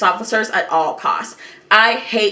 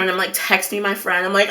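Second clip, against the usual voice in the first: ordinary delivery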